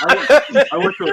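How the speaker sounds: laughing through the apology; can barely get it out for laughing; half-laughing sorry to Crunk. Real, not acted.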